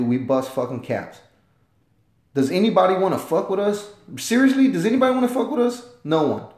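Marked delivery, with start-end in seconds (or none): 4.2-5.9 higher and faster, fired-up repeat; emphatic challenge. Real, not acted. Vlogger repeats his challenge to the world, higher and faster. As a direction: push it up and rush it like you dare them.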